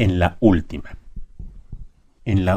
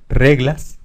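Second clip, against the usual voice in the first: reglas with RE punched higher; stress on first syllable; 4 semitones higher, 3 dB louder than usual. Shown as a demonstration. Teacher showing the strong syllable to pupils, stressed RE in reglas. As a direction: lean on the RE of reglas, the last syllable light. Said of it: "la que tiene mayor fuerza".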